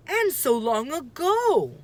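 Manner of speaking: a lightly voiced puzzled boy; Jack, puzzled. Jack questioning his sister, lightly voiced puzzled boy.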